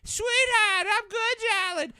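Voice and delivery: very high, loud screech of a Boston parent; parents' accented voices. Retold or imitated. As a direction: screech it like a mock Boston mom on the phone. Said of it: "calling my folks".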